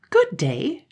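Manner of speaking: cheerful greeting. Acted. Village girl greeting the children, high and cheerful. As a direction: greet them brightly. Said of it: "in the same cheerful voice".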